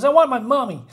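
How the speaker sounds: put-on scared child. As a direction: voice a frightened kid. Scared child Scotty in comic.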